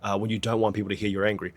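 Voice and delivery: ordinary talk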